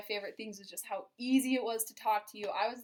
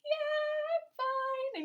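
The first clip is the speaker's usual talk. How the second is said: much higher, small mock reply; dazed reply; much slower than usual. She voices her knocked-over self answering, high and small. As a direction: pipe up high that you're fine. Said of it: "And I was like".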